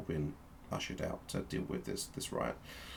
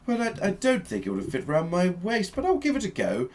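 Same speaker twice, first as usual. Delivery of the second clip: high-pitched, fussy Doctor voice; hesitant Doctor; 11 semitones higher, 5 dB louder than usual. Acted. Doctor asked to put a suit on, doubtful but game. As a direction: stammer a little, high-voiced, then gamely agree.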